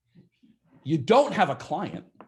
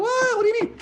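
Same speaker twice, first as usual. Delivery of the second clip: much higher, startled double take; surprised buyers; 3 dB louder than usual. Retold or imitated. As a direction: squeak it out in shock. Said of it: "they're gonna be like".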